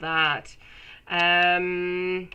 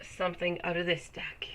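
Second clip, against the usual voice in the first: soft whisper to yourself; hushed aside; 8 dB quieter than usual. Real, not acted. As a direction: whisper it as you pull a card. Whispers while drawing cards.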